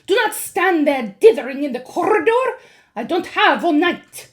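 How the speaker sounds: loud, high impatient witch snap; snapped. A narrator's reading. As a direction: hurry them in sharply. Witch scolding.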